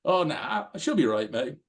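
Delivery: a breezy Australian brush-off, starting high; imitating a casual Australian bloke; Australian accent. A young man brushing off a second warning, in an Australian accent. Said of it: "that Australian accent of his".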